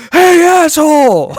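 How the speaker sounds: loud, high, drawn-out yell; hammed-up bellow at another driver; mock sudden shout. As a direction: imitate a caller erupting mid-chat. Retold outburst breaking a calm conversation.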